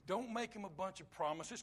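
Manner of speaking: ordinary talk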